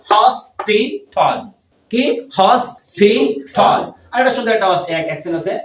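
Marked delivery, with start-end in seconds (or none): 0.1-1.4 "hospital" slowly split into syllables; teacher's slow syllable count. Shown as a demonstration. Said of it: "কয়টা syllable?". 2.4-3.8 very slow "hospital", each syllable apart; teacher's slow syllable demo. Shown as a demonstration. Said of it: "কয়টা syllable?".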